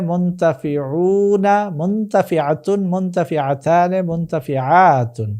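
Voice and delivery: slow, high sing-song chanting of word forms; drill recitation. Shown as a demonstration. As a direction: recite like a grammar table, drawing out long vowels.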